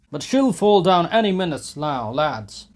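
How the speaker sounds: loud, high call; sporting shout. A narrator's reading. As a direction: call it like a bet. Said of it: "shouted a sportsman in the crowd".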